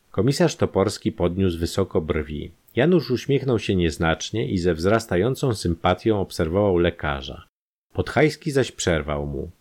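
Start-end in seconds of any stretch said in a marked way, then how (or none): none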